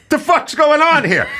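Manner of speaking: high, laughing mock exasperation; exasperated outburst. Companion baffled by the kiosk, high laughing exasperation. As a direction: blow up in mock frustration, way up high. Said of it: "and then they go".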